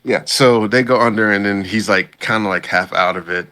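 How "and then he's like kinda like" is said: ordinary delivery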